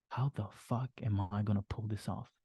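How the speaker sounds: quietly imitating a panicked thought; anxious inner thought. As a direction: voice the thought you have before sleep, hushed. The doubt voiced at bedtime.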